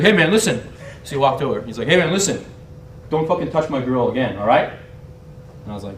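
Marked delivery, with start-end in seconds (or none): 0.0-0.6 louder, higher mock tough guy; imitated confrontation. British guy confronting a rival, pushy and loud. 1.9-4.7 mildly raised mock-threatening voice; retold warning. British guy warning him off his girl, mock-menacing.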